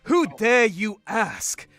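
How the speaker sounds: loud, slow, haughty challenge; haughty threat. Acted. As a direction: boom it out like an offended god. Reading character's threatening comic line.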